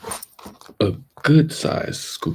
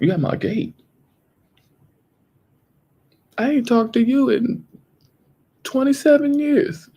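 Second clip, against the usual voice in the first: high, fast, exasperated voice; indignant; 14 semitones higher, faster than usual. Pretend. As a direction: act fed up with the caller. Acts annoyed friend at gate.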